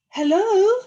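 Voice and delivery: high, loud questioning hello; calling out. A narrator's reading. Little Beaver calling across the pond, loud and high. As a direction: call across the water. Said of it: "he called".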